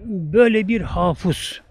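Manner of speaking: normal talking voice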